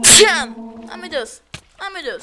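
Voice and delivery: loud, high gasping cries; startled exclamation. Real, not acted. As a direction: blurt out in sudden fright. Sudden scare in game. (exclamação assustada)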